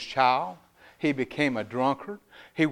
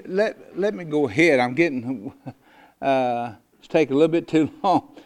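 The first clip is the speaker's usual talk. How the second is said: ordinary talk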